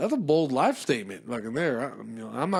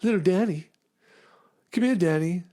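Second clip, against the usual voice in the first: higher, louder sweet calling; mock parent calling; 6 semitones higher, 5 dB louder than usual. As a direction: coax the kid in. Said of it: "Just call him something else".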